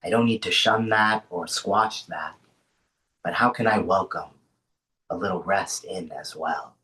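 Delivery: unhurried grounding voice with long pauses; guided meditation. Acted. Host settling an online group into rest, guided meditation. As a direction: ease them into rest, leaving space between phrases.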